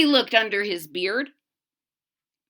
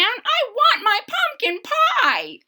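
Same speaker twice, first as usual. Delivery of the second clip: put-on whiny old man; 14 semitones higher, slower than usual. Old man whines for pie.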